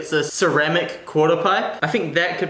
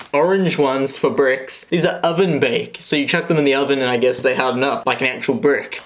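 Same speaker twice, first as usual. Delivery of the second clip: ordinary talk, nothing marked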